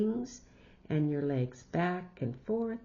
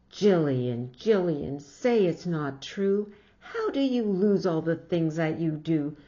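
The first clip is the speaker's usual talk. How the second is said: louder, scolding mother voice; exasperated mother; 5 dB louder than usual. Acted. Mother scolding Jillian for losing her hat, exasperated. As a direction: tell her off, loud and fed up. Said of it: "mother scolding, quote in story".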